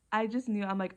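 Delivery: ordinary talk, nothing marked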